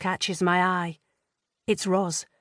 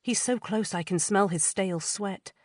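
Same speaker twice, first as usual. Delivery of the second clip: usual speaking voice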